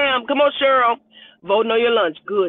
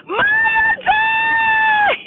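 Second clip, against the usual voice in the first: much higher, squealed name; excited shout; 4 dB louder than usual. Real, not acted. Greeting a dear friend on the livestream, thrilled squeal. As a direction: shriek her name with joy.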